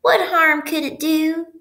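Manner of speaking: high, slow coaxing little voice; wheedling pigtail. A narrator's reading. Pigtail tempting the boy, wheedling. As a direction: stretch it out high and innocent. Pigtail keeps urging Paul.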